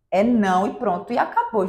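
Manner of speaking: ordinary delivery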